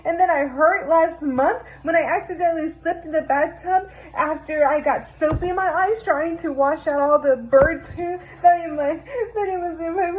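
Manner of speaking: put-on high, tearful little boy's wail; upset kid complaining between sobs; crying child. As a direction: blurt out the whole sad story through tears. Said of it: "Until the little boy cried".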